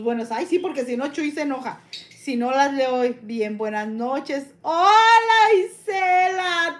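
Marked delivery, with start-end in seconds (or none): 4.6-6.8 loud, high, slow happy greeting; effusive greeting. Real, not acted. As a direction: call out the hello high and drawn-out. Saludo efusivo a una seguidora. (saludo efusivo, alargado)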